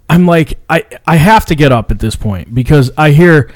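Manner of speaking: ordinary delivery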